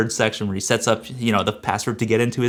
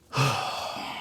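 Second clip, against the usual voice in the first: weary sigh; 9 dB quieter than usual. Real, not acted. Sigh after exasperation.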